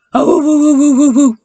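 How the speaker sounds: loud howling woos; wild dog howl. Acted. Wild dog scares Paddington, loud howling. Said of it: "the Siberian wild dog went".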